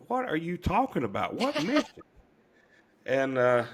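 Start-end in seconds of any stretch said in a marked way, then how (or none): 0.1-2.0 baffled nurse. Retold or imitated.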